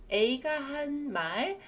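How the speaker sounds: normal talking voice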